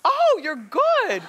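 high, slow, impressed gush; "oh, you're good" in a delighted gush. Real, not acted. Impressed reply to audience.

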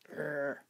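soft, low frustrated urgh; quiet groan of disappointment; disappointed groan. Real, not acted. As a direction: groan at the failed stamp. Stamp fails.

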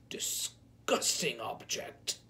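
slow, hissed in revulsion; disgusted whisper. A narrator's reading. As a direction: whisper it, recoiling. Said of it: "he cried".

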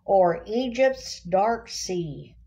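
ordinary talk

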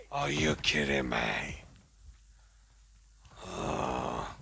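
low, growled disbelief. Real, not acted. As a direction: drop the voice deep and grumble. Repeated in a low voice.

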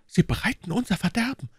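high-pitched desperate warning; anxious, high female character voice; alarmed outcry. Acted. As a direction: warn him in alarm as the young woman. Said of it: "rief Madame Bonacieux".

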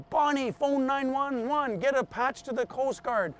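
retold belting out. Man calls to his wife for help, mildly belted out. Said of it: "belts out to his wife".

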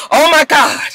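high mock shock; startled gasp. Recapping the dream scene, a startled high oh my God. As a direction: jolt awake in fake panic.

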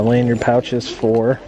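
ordinary talk, nothing marked

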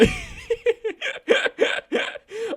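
long, high laughing fit; helpless laughter. Real, not acted. Player who dropped again, high fit of laughter. Drops again after a jump.

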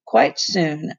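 ordinary delivery, nothing marked

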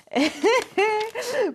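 a quick high laugh; brief real laugh; short laugh. Real, not acted. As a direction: laugh lightly to herself. Laughs before drinking mate.

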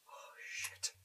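voiceless, barely there curse; frightened whisper. Real, not acted. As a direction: whisper it as if something might hear. Scared in horror game.

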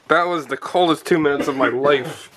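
ordinary talk

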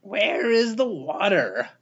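high, raspy little creature; small raspy voice. A narrator's reading. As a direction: rasp it out as a small hatchling. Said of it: "said in a small, raspy voice".

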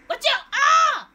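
high, loud warning breaking into a yell; alarmed shout. Real, not acted. Gamer warning of an incoming attack, alarmed shout. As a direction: shout a sudden warning, then cry out.

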